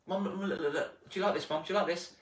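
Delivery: put-on a kid begging for a look; eager child.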